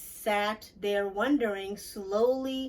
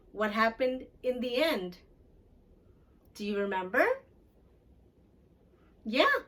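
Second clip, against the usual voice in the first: put-on asking children questions; 2 semitones higher than usual. Reader asking listeners what happened. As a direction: draw the children into the story. Asks children about the story.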